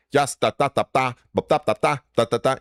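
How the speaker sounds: Swedish accent mimicry on nonsense syllables; main man Sui. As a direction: imitate a staccato Swedish-accented babble. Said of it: "you know how main man talks".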